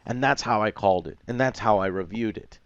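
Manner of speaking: normal talking voice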